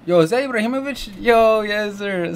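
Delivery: high, loud and fast, thrilled to spot him; excited recognition. Real, not acted. Vlogger spotting Ibrahimovic in the video, excited yelp. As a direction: blurt it out, can't believe who it is. Spots a soccer star in video.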